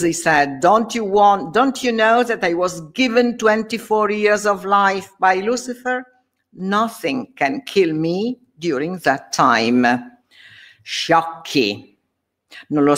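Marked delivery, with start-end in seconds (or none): 0.6-10.2 higher voice full of contempt; scornful sorcerer. Acted. Faustus taunting the knights, scornful sorcerer voice. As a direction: sneer down at the knights.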